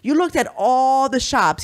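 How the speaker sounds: husband asking wife about the shops, lightly voiced; incredulous husband. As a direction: stretch it out in mock disbelief. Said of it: "he's like this".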